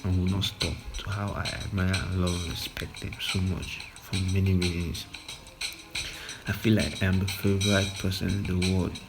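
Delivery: ordinary delivery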